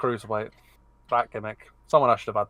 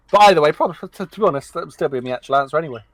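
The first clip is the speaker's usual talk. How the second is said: ordinary delivery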